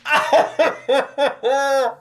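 laughing hard and loud. Real, not acted. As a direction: crack up mid-sentence. Laughing fit after reading.

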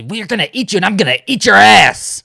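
over-the-top imitation of a cornered girl threatening; Misty threatening Coach. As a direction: ham up Misty's desperate threat, big and shrill-pitched. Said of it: "trying to stop him first by saying".

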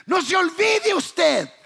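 high, slow preacher's cry; emphatic exhortation. Real, not acted. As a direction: call out the warning, drawing it out. Emphatic warning to congregation, then repeated. (exhortación exclamada)

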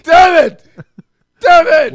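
sudden loud cry of pain; pained shout. Real, not acted. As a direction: yell it out after a hit. Kicked the table, cries out.

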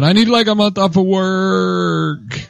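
hammed-up sickbed moan; pitiful whining. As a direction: drag it out like a self-pitying patient. Acting pitiful sick man.

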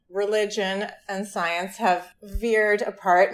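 ordinary talk, nothing marked

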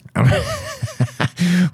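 high-pitched squealing giggle; a friend's goofy high-pitched cackle; imitated high giggle. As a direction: mimic a friend's weird high laugh. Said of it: "he'd just be like".

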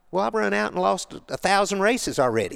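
high-pitched retold exasperation; exasperated protest. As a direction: argue back, voice climbing high. Replaying his protest in the race.

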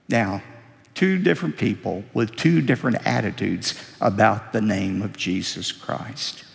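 usual speaking voice

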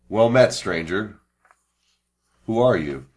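slow, measured in-character hail; calling out to stranger. Acted. Adventurer to a kneeling stranger, calling out. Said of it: "call out to him".

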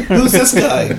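laughing. Pretend. Repeats the joke, laughing.

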